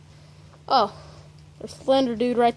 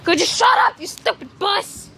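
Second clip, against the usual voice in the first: angry yell; 6 semitones higher than usual. Real, not acted. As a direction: yell at it to shut up. Yelling at noise outside.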